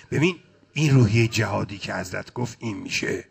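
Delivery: usual speaking voice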